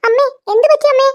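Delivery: high, anxious pleading; worried calling. Acted. Daughter-in-law waking mother from a nightmare, anxious calls. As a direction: shake her awake, alarmed.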